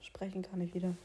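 leise geflüstert; flüsternd. Real, not acted. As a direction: kaum hörbar flüstern. (geflüstert)

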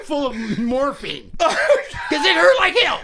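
high laughter all through the words; laughing hard. Real, not acted. Telling his brother about the butt rocket, helpless laughter. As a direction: laugh so hard it breaks the sentence.

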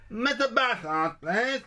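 very high, slow squeaky caller voice; silly prank voice. Pretend. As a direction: stretch the name out high and silly.

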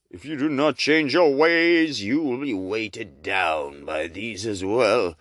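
slow, drawn-out booming ghost voice; ghostly warning. Acted. Chained ghost warning the miser, slow and booming. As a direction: intone it like a doomed spirit.

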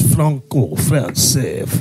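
low-pitched put-on French; French accent, mimicking a fussy corrector; mock French accent. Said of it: "Say it French".

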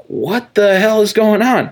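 high, drawn-out incredulous question; mock bewilderment. Mother baffled by the doctor, mock incredulous.

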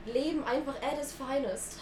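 ordinary talk, nothing marked

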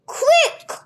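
loud, high urgent shout; urgent command. A narrator's reading. Captor hurrying the boy, urgent loud command. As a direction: bark it out to hurry him.

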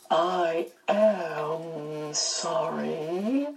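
very slow, drawn-out apology with a hint of amusement; amused Missus Witch. A narrator's reading. Missus Witch apologizing, amused, in a slow, drawn-out voice. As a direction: stretch every word, lifting at the end. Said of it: "more than a hint of amusement in it".

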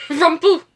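Rampu said loud with a laugh; Rampu, as a playful baby-talk lamp; silly mispronunciation. Pretend. Echoing silly word.

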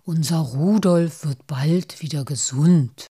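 slow, chant-like healing rhyme; slow, breathy healing charm; murmured incantation. A narrator's reading. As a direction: murmur a soothing spell, drawing out the words. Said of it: "und murmelte".

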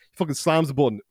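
ordinary talk

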